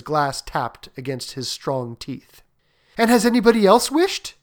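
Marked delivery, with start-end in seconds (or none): 3.0-4.3 high, louder insistent question; persistent old lady. Acted. Old lady pressing the soldier about others' wishes, persistently. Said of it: "persisted the old lady".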